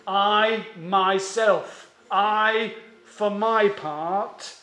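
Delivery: "I" hit loud, stretched out very slowly; emphatic I. Shown as a demonstration. Preacher showing the emphatic "I" of verse nine, slow and loud. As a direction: lean hard on each "I", unhurried. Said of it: "The "I" ... is emphatic".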